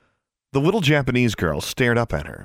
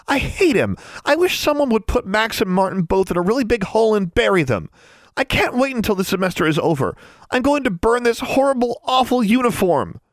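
high-pitched furious venting; raging; 13 semitones higher than usual. A narrator's reading. Anna blowing off steam to her roommate, raging. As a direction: rage away, voice way up high. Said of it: "As Anna raged".

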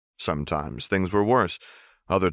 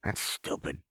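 muttered much softer, under the breath; 10 dB quieter than usual. Acted. Jinx dismissing Jericho's words, muttered under her breath. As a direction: grumble it under your breath. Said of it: "Jinx muttered".

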